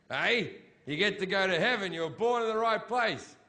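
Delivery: ordinary talk, nothing marked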